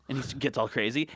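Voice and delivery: ordinary delivery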